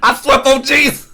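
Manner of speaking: high-pitched self-parody; Jay Washington. Acted. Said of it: "That's exactly what you sound like".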